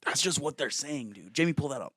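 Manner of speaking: excited bro host calling to the producer; Joe Rogan. Acted. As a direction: play an insistent, pumped-up podcaster. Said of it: "You have to do your Joe Rogan".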